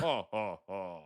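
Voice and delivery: low, round creature laugh; mock alien chuckle; Jabba. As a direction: roll out deep ohs. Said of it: "Crack Duck is Jabba".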